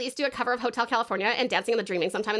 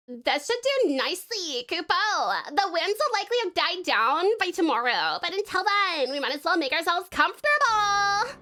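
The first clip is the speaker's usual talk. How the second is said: put-on higher-pitched valley girl reading game dialogue; valley girl storyteller, pitched up; valley girl moogle; 6 semitones higher than usual.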